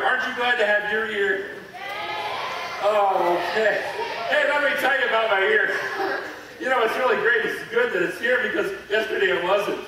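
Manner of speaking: loud, gushing delight over a healed ear; bubbling over with joy, laughing; thrilled show-and-tell, laughing between words; excited. Acted. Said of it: "I'm so excited".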